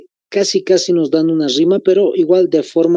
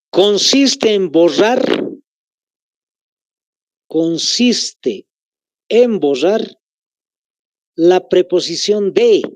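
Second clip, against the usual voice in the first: slow dictation; slower than usual. Shown as a demonstration. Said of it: "Les vuelvo a repetir".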